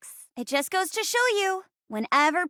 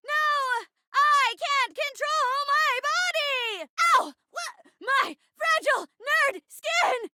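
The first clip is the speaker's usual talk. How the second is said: high, stretched-out shouting; slow, melodramatic high cries; theatrical wail; 11 semitones higher, slower than usual. Acted. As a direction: wail each word in hammed-up agony. Possessed victim cries out in play.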